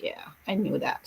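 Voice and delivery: unvoiced whispered aside; hushed kid's mumble. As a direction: breathe it like a sheepish kid. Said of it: "and it's like".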